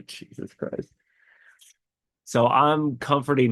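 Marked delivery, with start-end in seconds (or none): 0.0-0.9 a breathy tearful laugh, barely voiced; laughing through tears. Real, not acted. Dad retelling comforting his crying baby, tearful laugh. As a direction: laugh at yourself while crying. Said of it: "like I am now".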